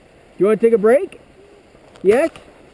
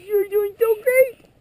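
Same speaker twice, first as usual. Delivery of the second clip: encouraging; 8 semitones higher, 4 dB quieter, slower than usual. Real, not acted. Praising toddler on skis.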